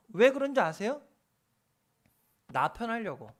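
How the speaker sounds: normal talking voice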